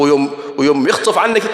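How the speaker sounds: ordinary talk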